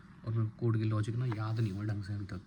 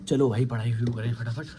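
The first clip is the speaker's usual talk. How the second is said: louder, brisk call to begin; mock eager start; 7 dB louder than usual. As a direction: pump yourself up to study. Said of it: "recreate that". (जोश में ज़ोर से)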